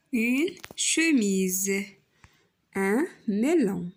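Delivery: slowly stretched words; slow teacher demo. Shown as a demonstration. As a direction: spell out each syllable slowly. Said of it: "نشوفوا لو سون كي سو ريبيت". (نطق بطيء واضح)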